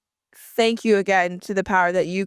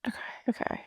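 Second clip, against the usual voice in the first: whispered okay; 14 dB quieter, much faster than usual. Real, not acted. Name guessing.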